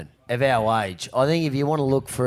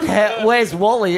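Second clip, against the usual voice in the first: slow, high mock search call; teasing friend misquoting the cry, mock alarm; joking mix-up; 10 semitones higher, 7 dB louder than usual. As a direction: play the wrong name for a laugh. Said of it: "It's not".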